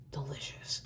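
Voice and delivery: hoarse, whispered from a cold; sick, raspy whispered voice. Real, not acted. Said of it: "Forgive my voice".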